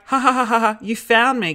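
high, read-out ha-ha laugh; imitating a delighted reply. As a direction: voice a gushing text message. Reads out Andrew's typed reply.

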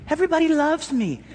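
high, gushing self-adoring mock quote; mock Sally Field. Preacher mocking a self-adored worker, high gushing. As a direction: gush like you think the whole world adores you. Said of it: "don't act like Sally Field".

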